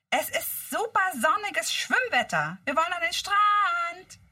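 loud, high, slow excited call. Acted. Bob tells Justus they want to go to the beach, loud and eager. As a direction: announce the beach plan loudly.

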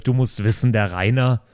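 ordinary talk

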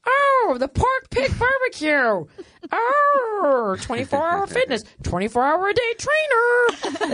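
put-on high, slow old-lady voice reading street signs aloud; mock an elderly passenger reciting every sign; old person reading signs. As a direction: sister parroting signs like an old parent, high and drawn out. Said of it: "You know the way old people".

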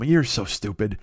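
normal talking voice

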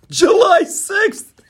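high, slow, squeaky mock exclamation; mocking Biden's flub. Host mocking Biden's flub about July sixth. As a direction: mimic the slip with a shrill cry. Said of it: "caught Joe Biden talking".

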